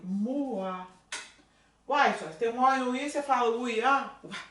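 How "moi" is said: "Moi" said as mwa, the oi turned into wa; French moi. Shown as a demonstration. Teacher showing students how French moi sounds. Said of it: "como é que eu pronuncio".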